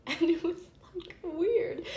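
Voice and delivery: high, loud, laughing through the words. Real, not acted. Reader recalling something weird that happened, real laughing. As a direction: crack up while recalling it. Laughing over own similar memory.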